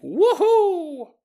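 high, loud drawn-out whoop; excited cheer. Retold or imitated. Scott cheering about helping the old man move, excited whoop. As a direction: whoop like a thrilled kid. Boy cheers at being asked along.

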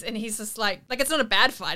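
ordinary talk

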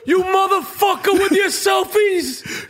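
high, slow furious tough-guy insult; Danny Aiello impression. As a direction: spit it out like Sal from the pizzeria. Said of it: "That's Danny Aiello".

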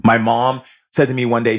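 normal talking voice

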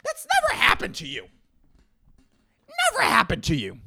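squeaky high, incredulous; mock disbelief. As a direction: act shocked at the absurd idea.

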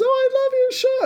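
squeaky high, thrilled fan gush. Retold or imitated. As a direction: mimic a starstruck fan, way up high. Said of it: "She goes".

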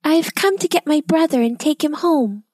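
put-on bright little girl answering bravely; young girl Elsie. A narrator's reading. Elsie answering the witch, high young girl voice. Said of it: "said Elsie".

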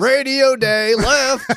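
barked chant, high and loud; stiff barking toy soldier. Pretend. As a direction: bark it like a drill sergeant. Joining the marching chant.

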